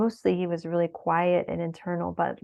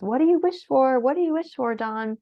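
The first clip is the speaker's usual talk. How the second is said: higher, louder, playful asking; retelling a lively birthday question, pitched up; cheerful coaxing question; 7 semitones higher, 4 dB louder than usual. Retold or imitated. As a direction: coax the birthday boy cheerfully. Asking Don his birthday wish.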